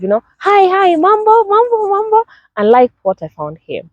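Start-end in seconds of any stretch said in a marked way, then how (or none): 0.4-2.2 high, bouncy mock street greeting; lively greeting. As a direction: sing out the hellos with energy. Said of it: "people are there with the vibe".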